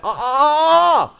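high, stretched "uh" wail. Acted. Preacher objects to a careless attitude to sin, high mock wail. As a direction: wail it out in protest.

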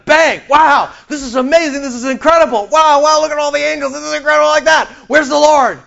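high, loud, amazed cries at heaven's sights; awestruck arrival. Pretend. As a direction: play a newcomer dazzled by heaven.

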